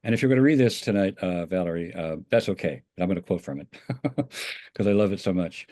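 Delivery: ordinary talk, nothing marked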